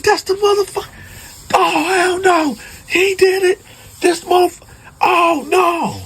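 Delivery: high screaming, breaking into a cry at the end; anguished scream. Retold or imitated. Husband screaming at his family's killer, then crying. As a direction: howl it out at the man who did it. Grieving man sees the killer.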